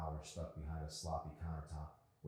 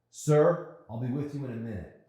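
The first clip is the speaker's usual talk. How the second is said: loud, higher firm reply; forceful; 8 semitones higher, 12 dB louder than usual. Acted. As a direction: push back hard at the rude man. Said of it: "Arson replied forcefully".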